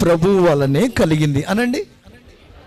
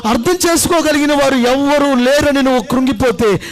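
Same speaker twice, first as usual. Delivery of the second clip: ordinary talk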